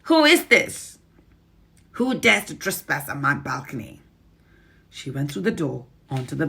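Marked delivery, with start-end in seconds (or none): 0.0-4.0 high, loud imperious witch demanding who's there; a witch roaring at an intruder; Grand High Witch. Acted. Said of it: "she muttered".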